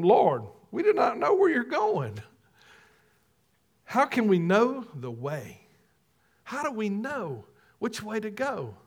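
lightly voiced puzzled disciple; a hint of a bewildered Thomas asking. Preacher voicing Thomas's doubt, lightly puzzled.

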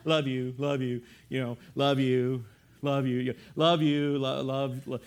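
low, droning love-yous; automatic, monotone endearment in low pitch; rote, meaningless. Shown as a demonstration.

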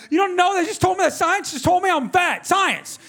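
high-pitched, hammed-up indignation; retold worked-up outburst; mad rant. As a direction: protest as if deeply wronged. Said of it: "So I got mad at her".

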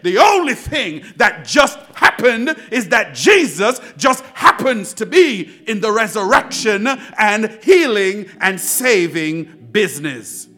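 loud, forceful preaching; vaaz coşkusu. Acted. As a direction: preach it out to the congregation, punching key words.

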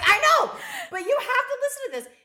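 high, fast and loud through laughter; laughing plea. Retold or imitated. Urging her coworker to listen to the call, laughing hard. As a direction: beg her to listen while cracking up. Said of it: "I'm like".

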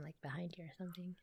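quick hushed whisper. Real, not acted. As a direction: drop to a fast whisper. Whispering asides.

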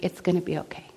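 soft, gentle promise; quiet reassurance. Retold or imitated. Jesus gives her that look, quiet reassurance.

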